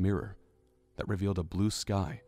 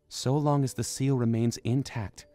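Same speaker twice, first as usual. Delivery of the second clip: put-on higher young princess voice, earnest; Zelda explaining; 4 semitones higher, 3 dB louder than usual. A narrator's reading. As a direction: lay out the plan as the princess, pitched up.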